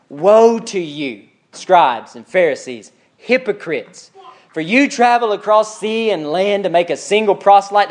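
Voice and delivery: slow, weighty condemnation; Jesus, upset. Acted. Jesus denouncing scribes and Pharisees, grave and upset. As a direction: draw out each title as an accusation. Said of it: "Jesus was upset with them".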